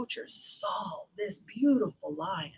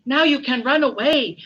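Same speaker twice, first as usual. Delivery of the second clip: higher, loud, eager call; excited mouse; 5 semitones higher, 10 dB louder than usual. Acted. Mouse to the freed lion, excited urging. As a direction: call it out with relief.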